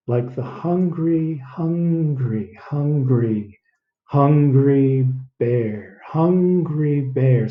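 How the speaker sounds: "hungry" stretched slowly each time; repeating hungry. Shown as a demonstration. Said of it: "Do it a few times".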